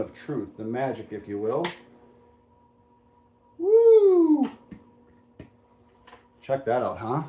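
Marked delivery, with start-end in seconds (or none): 3.6-4.5 excited whoop. Real, not acted. Unveiling the finished part.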